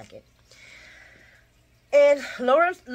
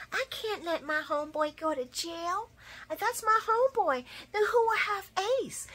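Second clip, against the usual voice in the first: a hint of a pleading friend, much higher; mock Elizabeth; 10 semitones higher, 4 dB quieter than usual. Elizabeth justifying not testifying, mock pleading. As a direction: whine the excuse.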